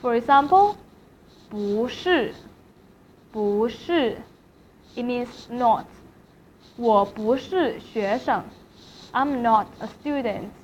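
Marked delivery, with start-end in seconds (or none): 1.5-4.2 不 rising, then 是 falling; second tone on 不. Shown as a demonstration. Teacher giving an example, rising tone on 不 before 是. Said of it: "不 in the second tone". 6.8-8.4 slow sentence with 不 rising before 是; second tone on 不. Shown as a demonstration. Teacher giving a full example, slow rising tone on 不. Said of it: "不 in the second tone".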